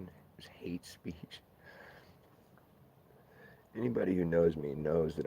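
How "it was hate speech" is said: hushed mock whisper of the charge; whispered mock quote. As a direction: breathe it out like a secret. Mock quote of a ban reason.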